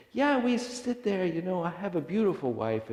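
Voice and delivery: put-on eager, high-pitched old man chatting to a stranger; enthusiastic old man. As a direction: gush happily in a high voice.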